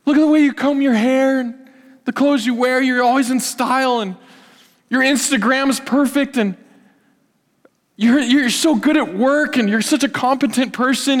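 butter them up cheerfully; exaggerated sweet-talk; flattering praise. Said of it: "gospel doesn't come and say".

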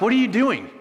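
a mildly alarmed owner; surprised challenge. Retold or imitated. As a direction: catch them in the act. Owners confront the disciples.

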